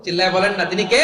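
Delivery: shouted question to the crowd; loud crowd-rousing preacher shout; rousing call. Real, not acted. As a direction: yell for the crowd's answer. Calls crowd to answer loudly.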